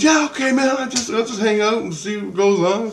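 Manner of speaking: high easygoing dude voice, shrugging it off; breezy, no-worries friend; laid-back Rusty. Retold or imitated. Rusty shrugging off being left alone, high and easygoing.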